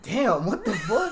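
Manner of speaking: high, drawn-out amazed laugh-line; stunned and amused; mock shock while laughing; laughing disbelief. Amazed reaction retold.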